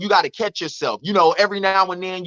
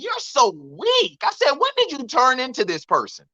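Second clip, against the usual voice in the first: disgusted scolding; 5 semitones higher than usual. Retold or imitated. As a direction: draw out the disgust, pitched up high. Retells scolding his mother with disgust.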